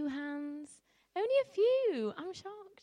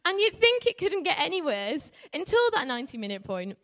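ordinary talk

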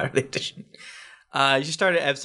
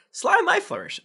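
much higher dramatic name; high name call. Acted.

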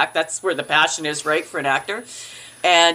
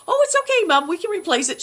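much higher, light reassuring tone; reassuring daughter; 11 semitones higher, faster than usual. Retold or imitated. Reassuring her mother about breakage, high light reassurance. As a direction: brush it off brightly. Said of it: "And I said".

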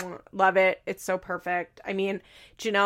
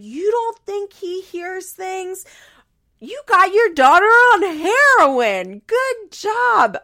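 higher, much louder, slow yelling; mock furious yell; 11 semitones higher, 9 dB louder, slower than usual. Boyfriend yells at grandmother about heroin, slow and loud. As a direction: hurl it at her slowly. Nate losing it, retold.

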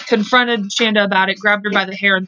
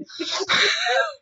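a high burst of giggling; high giggle; 6 dB quieter than usual. Real, not acted. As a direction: giggle at yourself. Laughs at own distraction.

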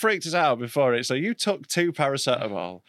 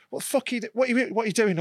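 escalating retort; 8 semitones higher, much faster than usual. Retold or imitated. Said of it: "it proper, like, escalates".

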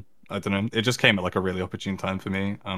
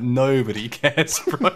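laughing; 5 semitones higher, 5 dB louder, slower than usual. Retold or imitated. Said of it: "And I'm like".

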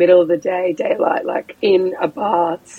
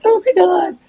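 high-pitched oh-my-god; retold alarm; 12 semitones higher, slower than usual. Woman watching the men start fighting, alarmed. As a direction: squeak it in mock alarm.

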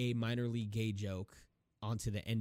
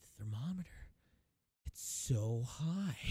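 ominous hush; 4 dB quieter than usual. Retold or imitated. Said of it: "he's like".

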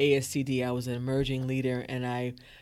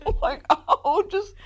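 mock exasperated wail; 6 dB louder than usual. Acting out wanting her to go.

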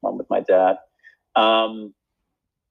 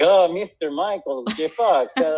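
hammed-up Russian accent, pitched higher; Yakov Smirnoff accent; 7 semitones higher than usual. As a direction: gush over the star in a thick Russian accent. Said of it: "I was essentially doing Yakov Smirnoff".

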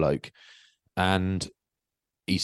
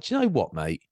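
non-aggressive exasperation; 2 semitones higher than usual. Retold or imitated. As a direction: pitch it up, fed up but not threatening. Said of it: "I wasn't aggressive. I was just like".

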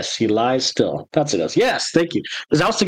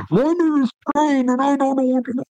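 high, muffled masked villain voice; muffled Bane voice; 12 semitones higher than usual. Retold or imitated. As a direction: speak through a hand over the mouth, pitched up. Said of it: "he sounds a little more muffled".

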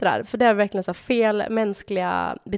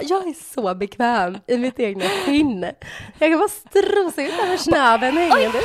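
slow, higher, self-satisfied voice; smug naked swimmer; 5 semitones higher, 4 dB louder than usual. Retold or imitated. Naked man proudly strolling past pool, smug and slow. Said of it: "Han bara".

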